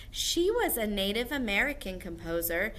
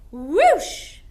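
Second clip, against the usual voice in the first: loud rising whoosh; ghostly whoosh; 9 semitones higher, 13 dB louder than usual. Shown as a demonstration. Teacher shows the class a ghost swooping. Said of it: "ghosts swoop".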